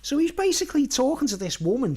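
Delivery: ordinary delivery, nothing marked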